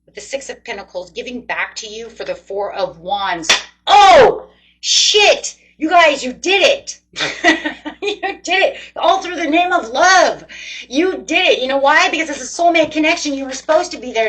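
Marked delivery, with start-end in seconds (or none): 3.9-14.3 high, loud whoop of triumph, laughing in the middle; real elated shouting, pitched way up; thrilled outburst. Real, not acted. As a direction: shout it out, overjoyed at the win. Thrilled by the winning card.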